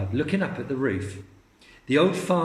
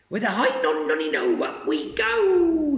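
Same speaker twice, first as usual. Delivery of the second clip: Grandma shouting; 6 semitones higher, 3 dB louder than usual. Acted. Grandma crowing to George, very high singsong shout. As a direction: sing it out as she shoots up. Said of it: "she shouted".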